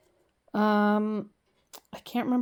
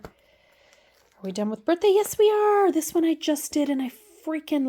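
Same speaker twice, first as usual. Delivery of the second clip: higher and louder, a bouncy self-answer; gleeful yes to her own question; excited; 9 semitones higher, 6 dB louder than usual. Real, not acted. Cheerful question and answer to herself.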